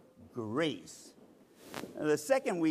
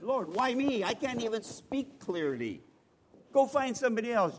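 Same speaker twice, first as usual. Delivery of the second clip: higher, pleading why me; Moses pleading; 7 semitones higher than usual. Retold or imitated. Moses in the wilderness begs the Lord, pleading higher. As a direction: protest to the Lord, pitched up and pleading. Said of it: "Moses in the wilderness".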